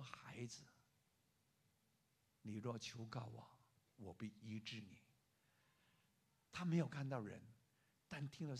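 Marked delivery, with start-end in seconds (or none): 0.0-5.0 low, soft, tender promise; gentle voice. Acted. An unseen Jesus promises the student healing, gently. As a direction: speak low with gentle assurance. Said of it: "很温柔的声音说".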